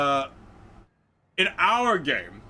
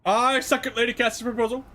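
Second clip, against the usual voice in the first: higher, louder formal character voice; 9 semitones higher, 6 dB louder than usual. Acted. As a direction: voice a bold noble ally. Player voicing character agreement.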